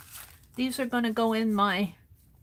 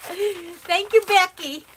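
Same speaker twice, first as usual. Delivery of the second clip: delighted thanks; 10 semitones higher, 7 dB louder, slower than usual. Real, not acted.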